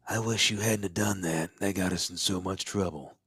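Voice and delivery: character voice. Shown as a demonstration. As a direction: lightly voice a second character. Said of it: "change your voice a little bit".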